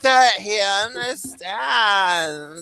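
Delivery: put-on drawled, very slow SoCal girl accent; Southern California female accent. Repeating the demo for listeners, Southern California female accent. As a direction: stretch it out in a valley-girl accent. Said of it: "wait, no, I'll do it".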